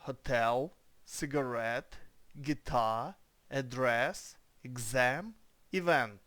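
Hotel, cigarette, guitar, address, exam, event, each final syllable stressed, word by word; correct stress demo. Shown as a demonstration. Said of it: "Це як це читається правильно".

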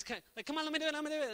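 put-on whiny nagging kid.

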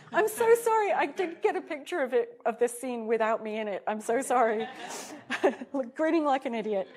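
loud, high-pitched embarrassed laughing; sheepish, giggly outburst over a blunder; laughing apology. Real, not acted. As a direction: apologise through real laughter, voice jumping up.

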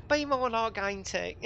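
high, loud put-on wife voice; mock moaning wife in a put-on accent; wife impression. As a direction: pitch it right up as a nagging wife. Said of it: "better than my impression".

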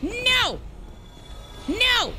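high-pitched wailed NOs; repeated swooping cries of protest; yelping no. Real, not acted. Gamer watching a run go wrong, high wailed protest. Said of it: "I'm yelling and I'm being obnoxious".

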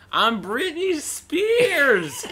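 very high, loud, drawn-out cry; mock diva outburst; melodramatic pop star. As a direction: stretch the name into a hammed-up squeal. Mimicking star's melodramatic outburst.

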